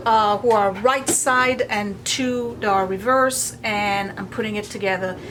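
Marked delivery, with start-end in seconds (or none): none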